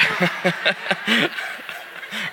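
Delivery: whispered chuckle; breathy laugh. Real, not acted. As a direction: chuckle at your own joke. Laughs at own joke.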